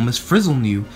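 usual speaking voice

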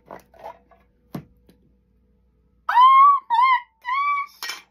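sudden high squeal of surprise; shrieked oh my gosh; startled gasp. Real, not acted. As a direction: jump out of your skin, high and loud. Singing bowl rings unexpectedly.

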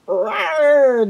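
high, loud cat's war yowl; battle cry. Acted. Firepaw springs at the unseen enemy, battle cry. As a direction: rawr out a fierce battle cry. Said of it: "The battle cry rumbled in Firepaw's throat".

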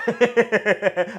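high loud burst of laughter; howling laugh. Real, not acted. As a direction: crack up hard. Laughing at Cassidy getting roasted.

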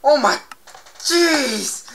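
loud high oh my jeez; startled exclamation. Real, not acted. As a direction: gasp it out in shock. Near fall.

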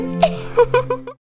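high, soft little giggle; light, coy high laugh. Acted. Teasing after inviting herself over.

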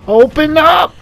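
very high and loud yell; frantic shout. Real, not acted. Player fleeing the monster, screaming at the door.

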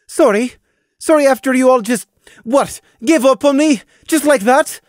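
high, loud, bitter outrage; loud, hurt accusation; angry disbelief. A narrator's reading. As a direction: throw his word back at him. Sister rages at her brother.

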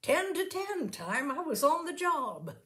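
high, bright, chirpy voice; brightly. A narrator's reading. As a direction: sound busy and cheerful. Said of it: "Missus Brady said at once brightly".

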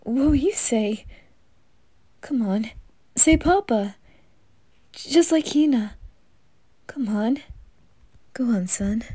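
excited dad coaxing his son; eager coaxing. Acted. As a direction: urge the baby to speak. Coaxing baby to talk.

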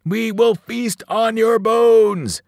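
loud, high, drawn-out giant's war cry; roar. A narrator's reading. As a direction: roar it slowly like a triumphant cannibal giant. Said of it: "roared Joe Bob the Cannibal".

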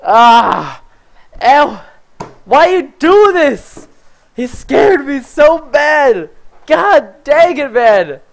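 high, loud yelling in shock; frightened yelling. Real, not acted. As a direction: scream it at the friend who snuck up. Scared badly by another player.

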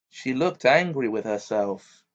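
plain reading voice

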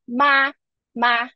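MA held high and level; first tone. Shown as a demonstration. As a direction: sustain MA on one high pitch. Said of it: "if it's in first tone".

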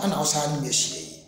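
ordinary delivery

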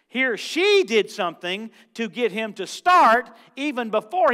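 loud, fervent pulpit delivery; preaching at full force. Acted. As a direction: preach it out hard to the congregation. Preacher building up the sermon.

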